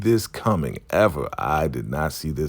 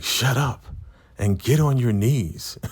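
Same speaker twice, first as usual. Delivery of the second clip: higher-pitched mock command; acted bossy order; 7 semitones higher than usual. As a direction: order them down in a raised pitch. Acting out Zane's order.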